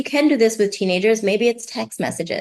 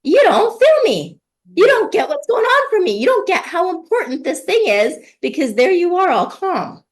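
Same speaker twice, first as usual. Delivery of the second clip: high, louder voicing of a frustrated child; upset kid protesting; 9 semitones higher, 4 dB louder than usual. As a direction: plead like a kid who feels unheard. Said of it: "because they're like".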